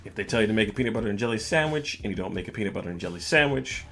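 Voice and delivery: normal talking voice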